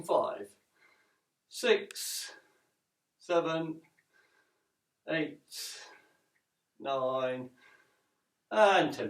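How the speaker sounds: counting while holding a squat; counting out reps mid-exercise; in a deep squat. Real, not acted. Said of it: "Hold the squat position".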